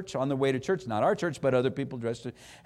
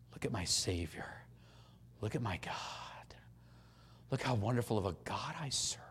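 hushed, breathy whisper of awe; reverent whisper; 7 semitones lower, 15 dB quieter than usual. Shown as a demonstration. Preacher whispering praise of God to the congregation.

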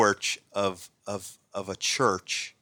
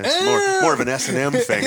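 mock kinky squeal; 8 dB louder than usual. Joking German sounds like S&M.

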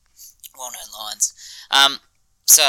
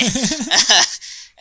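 laugh; 13 semitones higher, 4 dB louder than usual. Real, not acted. After quote.

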